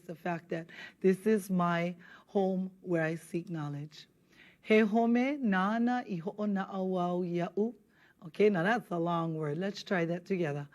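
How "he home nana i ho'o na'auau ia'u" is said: slow, careful Hawaiian line for students to copy; slow model reading. Shown as a demonstration. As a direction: spell out each word slowly for learners. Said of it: "reads line for students to mimic".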